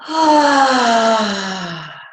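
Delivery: long sighed out ah; sighing ah. Shown as a demonstration. Coach leading the group exhale. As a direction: sigh it all out. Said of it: "Exhale, sigh it out".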